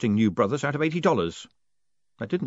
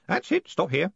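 put-on high, eager old man voice; a fussy old postman, keen to help; 9 semitones higher than usual. A narrator's reading. Groat voice.

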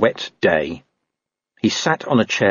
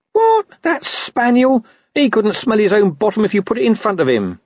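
indignant dog; 13 semitones higher than usual. Acted. Dog scorning a rival spaniel, high indignant outburst. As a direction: squawk with scornful disbelief.

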